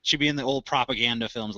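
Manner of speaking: ordinary delivery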